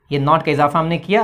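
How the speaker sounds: ordinary delivery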